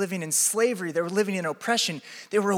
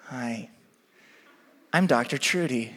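put-on frail elderly woman; 8 semitones lower than usual. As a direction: introduce yourself meekly as a frail old doctor. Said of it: "this elderly, sort of frail-looking woman".

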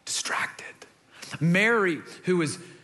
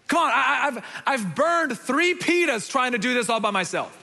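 frustrated complaint; 6 semitones higher than usual. Acted. Martha complaining to Jesus about her sister, exasperated. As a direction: whine to Jesus about being left alone. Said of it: "she came to him and she asked".

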